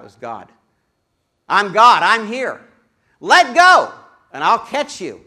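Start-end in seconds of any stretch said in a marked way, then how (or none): 1.5-5.2 louder, a touch higher divine call; booming God. Retold or imitated. God calling down to the hanging man, booming loud. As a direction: announce yourself from above, loud. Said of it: "a booming voice called out".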